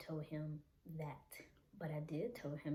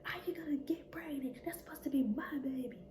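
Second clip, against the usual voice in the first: fast, high mock rant in a hushed voice; retold angry outburst; 8 semitones higher, 6 dB louder, faster than usual. Narrator voicing her abuser's angry reply to the pregnancy, mock rant. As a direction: imitate his furious reaction, rushed. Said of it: "he was on fire".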